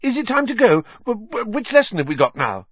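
a startled schoolgirl stumbling over her words; voiced high as a flustered young witch; Hermione, flustered. Hermione voiced, just woken.

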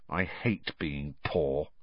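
low, soft and slow, bitter; gloomy. A narrator's reading. Ron glaring at his potato, low and miserable. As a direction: sink into it, quietly miserable.

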